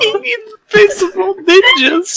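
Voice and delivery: laughing. Real, not acted. Crying with laughter.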